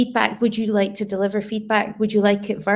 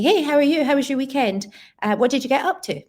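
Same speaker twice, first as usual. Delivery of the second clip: a bright-pitched volley of questions, run together; higher-pitched, hurried greeting with no room to answer; rapid-fire questions; 5 semitones higher, faster than usual. Shown as a demonstration. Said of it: "That's three questions".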